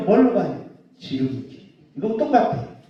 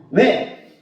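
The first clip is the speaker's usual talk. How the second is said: ordinary delivery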